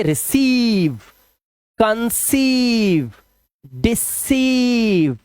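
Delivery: very slow words, holding "eee" in रिसीव, कंसीव; long vowel drill. Shown as a demonstration. Teacher drilling ei words, long vowel drill. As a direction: stretch the second syllable of डिसीव. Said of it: "long vowel sound ई ही रखना".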